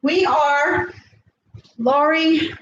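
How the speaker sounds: ordinary talk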